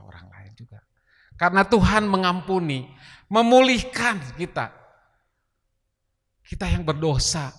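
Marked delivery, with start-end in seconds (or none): 1.4-7.5 loud, high, fervent preaching; berkhotbah berapi-api. Real, not acted. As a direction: proclaim it with full force to the congregation. Preaching at full force. (khotbah lantang penuh semangat)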